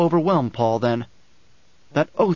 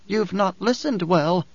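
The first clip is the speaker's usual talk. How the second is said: higher character voice, measured and firm; Dory, firm woman; 6 semitones higher, slower than usual. Acted. Dory urges Paul to take the dwarf, firmly. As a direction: lift the voice for a resolute woman.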